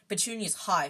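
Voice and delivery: ordinary delivery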